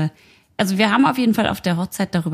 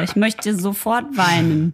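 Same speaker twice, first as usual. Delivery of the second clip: ordinary talk, nothing marked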